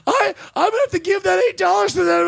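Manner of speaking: high, fast, panicky whine; mock tearful. Promoter fretting over what he owes, mock tearful babble. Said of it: "and starts crying".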